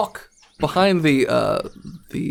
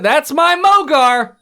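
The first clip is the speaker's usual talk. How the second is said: loud, slow proud praise; proud cheer; 8 semitones higher, 6 dB louder, slower than usual. Acted. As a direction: praise him like a proud parent.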